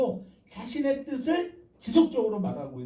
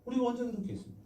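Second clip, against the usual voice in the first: higher-pitched, protesting question; 항변하는 이스라엘; 7 semitones higher, much faster than usual. Acted. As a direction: play a people denying the charge. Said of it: "이스라엘은 뭐라고 해요". (높은 목소리로 억울한 듯 되묻기)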